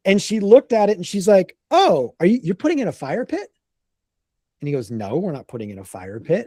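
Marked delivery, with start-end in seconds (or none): 1.7-3.5 higher-pitched daughter's curious question; imitating a surprised daughter. Daughter's puzzled question, acted. 4.9-6.5 low, soft dad's brush-off; imitating a dad quietly dismissing the idea; dismissive denial. Dad's denial answers the daughter.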